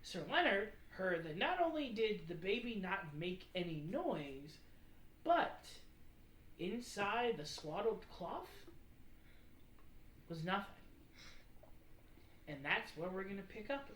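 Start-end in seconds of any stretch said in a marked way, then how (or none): none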